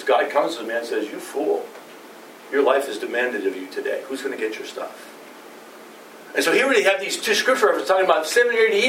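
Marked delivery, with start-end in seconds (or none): 0.0-5.1 low, hushed, solemn rebuke; quiet voice of God. Acted. Preacher voicing God, hushed and stern to the fool. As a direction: pronounce judgment softly, almost under the breath.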